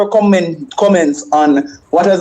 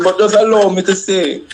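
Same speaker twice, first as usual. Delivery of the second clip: usual speaking voice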